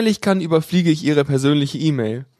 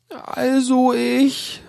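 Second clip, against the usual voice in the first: higher, slow, hesitant; unsure; 9 semitones higher, much slower than usual. A narrator's reading. Caller unsettled by the admin's warning, hesitant. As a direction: trail off uncertainly. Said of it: "sagt sie verunsichert".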